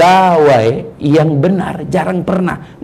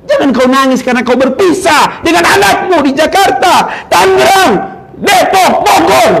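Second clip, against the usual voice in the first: loud, high preaching shout; fiery preaching; 14 semitones higher, 5 dB louder than usual. Acted. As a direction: call out each city. Preacher at full force.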